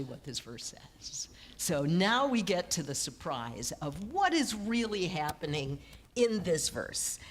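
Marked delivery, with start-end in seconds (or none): 0.0-1.3 whispered. Real, not acted. Insisting the verse is misread.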